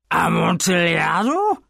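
high, slow, drawn-out cries of astonishment; drunken disbelief. Acted. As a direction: stretch every word in tipsy amazement. Fortunato repeats the wine's name. (betrunkener Fortunato, ungläubig und gedehnt)

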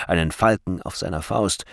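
plain reading voice

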